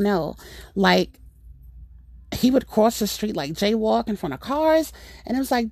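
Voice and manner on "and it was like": ordinary talk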